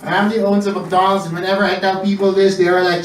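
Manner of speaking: ordinary talk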